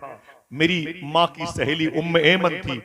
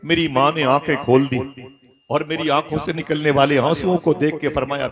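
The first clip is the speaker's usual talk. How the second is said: usual speaking voice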